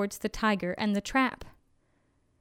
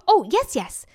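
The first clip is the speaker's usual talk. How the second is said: eager, louder jackal; 3 semitones higher, 6 dB louder than usual. A narrator's reading. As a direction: jump in with keen agreement. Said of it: "said the jackal eagerly".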